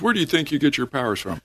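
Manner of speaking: retold indignant demand. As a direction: imitate someone affronted and challenging. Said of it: "he got all indignant".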